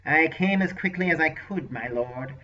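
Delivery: a hint of a fawning servant; nasal unctuous voice. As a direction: sorcerer answering his lord, lightly nasal and unctuous. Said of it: "a deeply nasal, unctuous voice responded".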